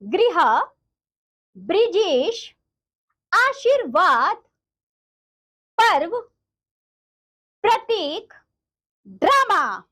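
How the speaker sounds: each word read out separately with pauses; clear reading aloud. Shown as a demonstration. Teacher reads each word clearly for the children. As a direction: say every word crisply for the class to repeat. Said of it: "सभी बच्चे मेरे साथ साथ पढ़ेंगे".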